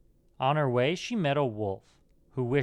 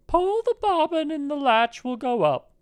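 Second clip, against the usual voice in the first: very high falsetto granny voice; old grandmother; 4 dB louder than usual. A narrator's reading. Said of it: "said the old grandmother".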